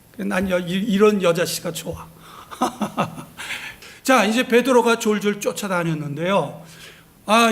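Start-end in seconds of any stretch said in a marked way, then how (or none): 2.5-3.7 soft breathy laugh. Real, not acted. Preacher laughs at own aside.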